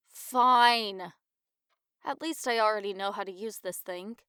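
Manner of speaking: normal talking voice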